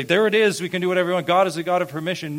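mock libertine's eager proclamation; pseudo-libertine. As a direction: toss it out fast and high, like a gleeful excuse. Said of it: "like a pseudo-libertine and say".

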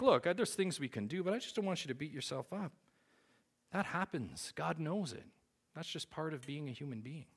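high-pitched, well-meaning reassurance; older brother consoling. Retold or imitated. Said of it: "he's like".

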